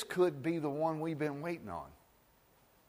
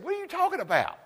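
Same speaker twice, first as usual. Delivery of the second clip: high, disbelieving question; can't believe what he just asked; high-pitched disbelief; incredulous disciples; faster than usual. Acted. Disciples baffled by Jesus.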